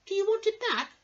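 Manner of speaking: high, squeaky mouse voice; tiny helpful mouse. Acted. Mouse explaining the toothache to the crocodile, squeaky.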